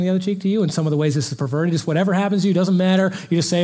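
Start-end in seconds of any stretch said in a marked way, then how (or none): none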